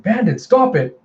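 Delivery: high-pitched reprimand; acted exasperated squeal of a girl; scolding. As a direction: tell off a misbehaving pet. Girl scolds her pet.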